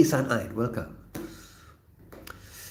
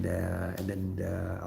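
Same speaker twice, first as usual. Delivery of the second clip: ordinary delivery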